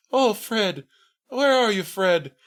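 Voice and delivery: high, drawn-out dramatic calls for Fred; theatrical sleep-talking. Retold or imitated. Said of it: "talking super clearly, super theatrically".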